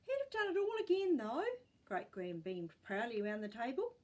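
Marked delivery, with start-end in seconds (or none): 0.1-1.4 put-on old woman voice. A narrator's reading. Great gran voiced in story.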